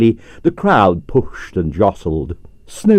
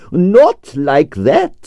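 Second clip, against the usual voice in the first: higher, slowly drawn-out objection; shocked; 8 semitones higher, much slower than usual. Acted. Stroganoff, shocked, corrects the detective's mimicry, slow and higher. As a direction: recoil in shock at the idea.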